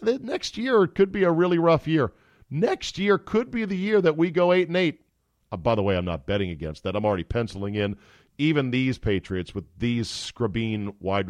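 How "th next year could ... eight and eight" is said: a higher, breezy imitated coach voice; high mock Belichick. Belichick hinting at a rough year, mock breezy. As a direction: shrug it off as the coach. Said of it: "Bill Belichick might be saying".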